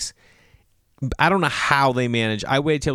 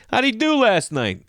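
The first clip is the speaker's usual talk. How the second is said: high-pitched club-member caricature; mock hearty holler; 11 semitones higher, 3 dB louder than usual. Club members greeting the visiting comedian, mock hearty holler. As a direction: hail him high, hamming up the nosy member.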